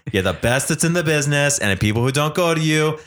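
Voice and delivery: hammy Trump rally brag about a wall; Donald Trump impression. Host showing off his Trump voice to his cohost. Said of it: "I told you I'm working on it".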